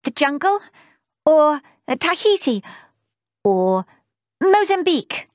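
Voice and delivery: put-on high, eager girl's voice rising on each place; bubbling over with choices; excited girl. A narrator's reading. Girl naming places to her uncle, eagerly and high.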